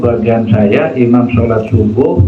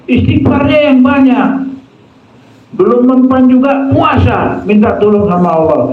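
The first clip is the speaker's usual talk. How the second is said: raised, insistent preacher's voice; fiery preaching; 6 semitones higher than usual. Acted. Preacher warning the congregation against idolatry, fiery and raised. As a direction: drive the warning home to the congregation.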